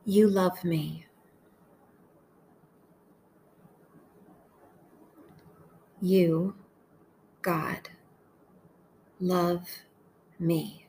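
slow, measured meditation voice with long pauses; unhurried, deliberate phrases for listeners to echo; guided prayer meditation. Acted. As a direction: pray each short phrase slowly, leaving space to repeat it.

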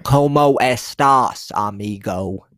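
put-on slow, loud, stiff American-accented Spanish; mock choppy gringo Spanish. Mocking a clumsy American accent in Spanish. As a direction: overpronounce it like a tourist reading a phrasebook. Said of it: "It's not like that".

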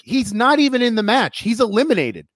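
high-pitched imitated objection; retold protest. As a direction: protest like an exasperated wrestler. Retold ringside exasperated retort to manager.

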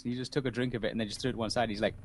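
usual speaking voice

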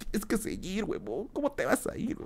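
egging him on as a mock classmate; classmates urging. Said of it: "mis compañeros vinieron y dijeron".

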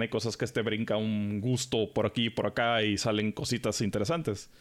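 ordinary talk

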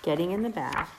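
gentle singsong read-aloud; reading to a toddler. Acted. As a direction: read it sweetly to little kids. Reads picture book to toddlers.

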